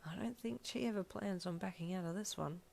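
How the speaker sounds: soft private remark; quiet, to yourself; muttered aside. A narrator's reading. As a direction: keep it under your breath. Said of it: "Kiri muttered under his breath".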